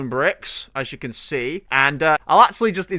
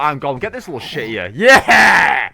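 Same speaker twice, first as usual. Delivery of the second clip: ordinary talk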